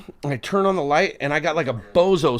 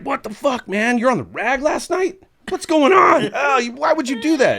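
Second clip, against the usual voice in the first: high-pitched mock outrage; indignant confrontation; 9 semitones higher than usual. Man phoning last night's date, high-pitched retold indignation. Angry phone call, retold.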